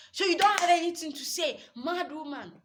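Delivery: high, loud mocking taunt; taunting shout. Husband shouts at silent wife.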